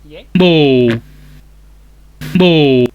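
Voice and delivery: booming shouted syllables; loud shout. Shown as a demonstration. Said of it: "तो अभी देखो मैं क्या बता रहा हूँ".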